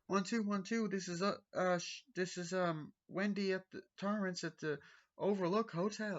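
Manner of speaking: high, hesitant mock radio call; imitating Wendy on radio. Wendy calling the ranger station in a high hesitant voice.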